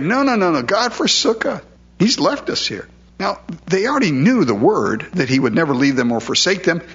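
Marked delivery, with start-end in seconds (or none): 0.0-2.9 high, pleading cry of the exiles; despairing Zion. Retold or imitated. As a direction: whine it like people who feel abandoned.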